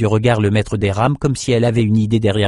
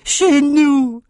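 much higher, strange voice, falling in glee; excited runt exclaiming. A narrator's reading. The runt runs to Bannon to repeat it, high and gleeful. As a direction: announce it with triumph. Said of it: "et répéta".

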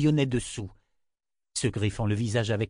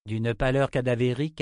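ordinary delivery

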